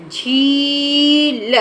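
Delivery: झील drawn out very slowly with a long ee; slow teaching demo; slow syllabified word. Shown as a demonstration. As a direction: sound out झील slowly for children. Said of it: "देर तक बोला हमने".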